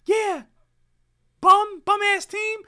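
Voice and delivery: very high squeaky trash talk; Blocky voice. Retold or imitated. Streamer voicing teammate Blocky talking trash, squeaky high. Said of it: "change my voice".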